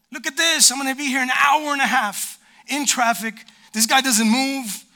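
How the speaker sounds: high, loud griping at the traffic; annoyed driver rant. Acted. Preacher acting a driver stuck in traffic, loud frustrated griping. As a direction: whine it like you're stuck in the car for hours.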